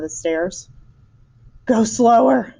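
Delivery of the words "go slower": panting, out of breath plea. Acted. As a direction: gasp it out, winded from the stairs. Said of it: "panted Missus Salt".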